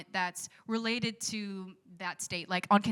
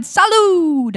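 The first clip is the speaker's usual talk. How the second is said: high, loud, drawn-out cheer; shouted toast; 10 semitones higher, 19 dB louder than usual. Acted. Traveller clinking glasses with strangers, shouted toast. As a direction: shout it out across a crowded table. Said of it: "strangers and shouted".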